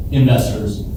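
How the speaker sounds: ordinary talk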